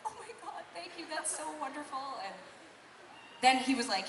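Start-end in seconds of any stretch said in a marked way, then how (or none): 0.1-2.2 high, soft, teary thanks; overwhelmed and near tears, voice lifting high; welling up. Retold or imitated. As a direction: choke up while thanking him. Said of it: "I totally welled up".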